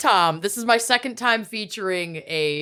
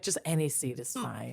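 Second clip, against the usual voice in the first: much softer, lower, defeated mumble; quiet mumble; 7 semitones lower, 14 dB quieter than usual. Retold or imitated. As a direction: mutter it like you've given up. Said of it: "we'd be like".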